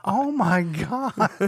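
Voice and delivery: high, slow, drawn-out disbelief; stunned. Retold or imitated. Recalling shock at the son calling his mom drunk. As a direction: let it hang in shock. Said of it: "And I'm just like".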